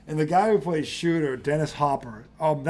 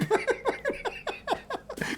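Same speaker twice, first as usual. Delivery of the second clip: high squealing laugh; 8 dB quieter than usual. Real, not acted. Cracking up at the scene.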